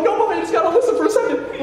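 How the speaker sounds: put-on very high, fast kid protest; frantic pleading. Boy stopping his mom, acted frantic pleading. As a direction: plead in a squeaky rush.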